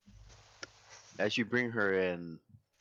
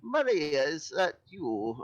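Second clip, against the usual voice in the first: put-on high, slow frail old woman; a squinting old granny calling out; 7 semitones higher than usual. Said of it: "squint at you a bit and go".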